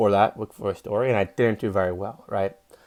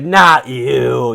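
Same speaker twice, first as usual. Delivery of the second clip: barked "Not you", louder; loud dismissive "Not you"; 8 dB louder than usual. Retold or imitated. Booker turning him away, a loud curt no. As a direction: shut him out hard, half shouted.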